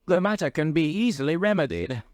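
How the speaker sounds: browbeaten. A narrator's reading. Browbeaten doctor yields to the Duke's secretary, appeasing. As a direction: give in, anxious to appease. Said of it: "said the browbeaten doctor".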